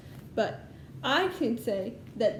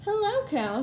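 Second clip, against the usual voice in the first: much higher, chirpy little duck greeting; cheery duck voice; 9 semitones higher than usual. Acted. Teacher voicing Duck greeting the farm animals, high cheerful. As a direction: pop up high as a friendly duck.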